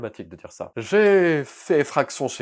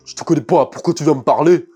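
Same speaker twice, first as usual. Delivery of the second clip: thug voice, much louder; Shane; 4 semitones higher, 12 dB louder than usual. Acted. Thug rebuffing a stranger who talks to him, loud thug voice. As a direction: bark it like a put-on thug. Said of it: "il a l'air d'un thug. Donc je vais lui faire la voix comme ça".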